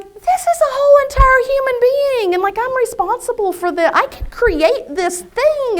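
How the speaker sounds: high-pitched, loud wonder at it all; amazed. Retold or imitated. New mother marvelling at her baby, amazed. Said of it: "Do you remember that amazement".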